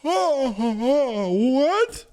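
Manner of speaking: hammed-up shocked What? through a laugh; mock shock. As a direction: fake astonishment with a laugh. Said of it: "Pretends to be shocked".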